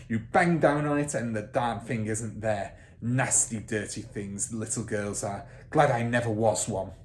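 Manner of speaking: loud, sneering headmistress tirade; domineering headmistress. Acted. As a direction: crush the teacher with contempt. Trunchbull voiced.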